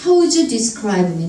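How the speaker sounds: "would you" blended into "wuju"; linked speech. Shown as a demonstration. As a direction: glide through "How would you". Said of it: "이렇게 됩니다".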